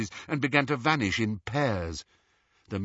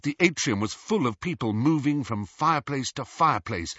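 plain reading voice

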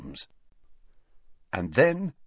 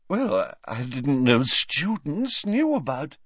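high, slow, dreamy seer voice; tipsy mystic seer; 5 semitones higher, slower than usual. Acted. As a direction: drift through it like a vague prophetess.

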